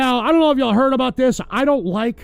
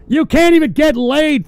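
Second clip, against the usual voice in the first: much louder and higher repeat; louder insult; 5 semitones higher, 6 dB louder than usual. Real, not acted. Host yelling insult at chatters. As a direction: shout the jab a second time.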